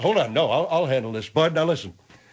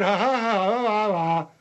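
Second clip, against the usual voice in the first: imitated mocking laugh; 3 semitones higher than usual. General accusing Bud of mocking, mocking imitation. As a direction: mimic his jeering laugh accusingly. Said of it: "You were mocking Margaret".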